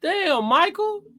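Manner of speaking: very high, drawn-out dismay; slow, high-pitched groan of a reaction; high-pitched exasperation. Real, not acted. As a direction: stretch it out high in disbelief.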